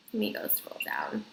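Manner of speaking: whispered aside to herself. Real, not acted. Vlogger scrolling her phone mutters to herself, whispering. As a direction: breathe it out under her breath while scrolling.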